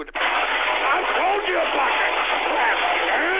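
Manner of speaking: fed-up scolding of chat trolls; angry scolding. Real, not acted. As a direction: drag out each word at them, fed up.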